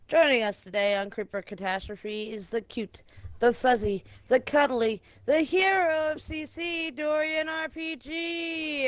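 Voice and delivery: drawn-out ring announcer; announcer intro. Acted. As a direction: build each name up slowly. Wrestling-style player introductions.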